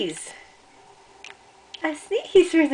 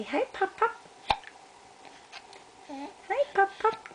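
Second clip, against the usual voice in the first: put-on high baby voice; 8 semitones higher, 3 dB quieter than usual.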